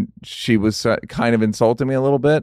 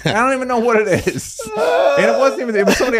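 words bubbling with laughter, higher; laughing; 10 semitones higher, faster than usual. Real, not acted. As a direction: laugh through the line. Laughing at own story.

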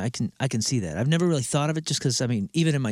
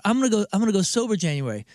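higher mock voice drifting back down; mocking excuse; 5 semitones higher than usual. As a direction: wriggle out of the promise, pitched up. Mocking ever-changing sobriety names.

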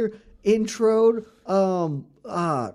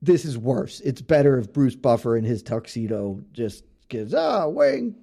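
mock naysayer; 6 semitones lower than usual. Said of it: "nobody would be like".

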